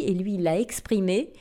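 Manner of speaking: usual speaking voice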